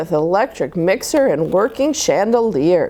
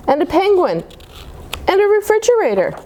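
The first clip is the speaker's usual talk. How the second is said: giggly; 11 semitones higher, faster than usual. Acted. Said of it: "Gertie giggled".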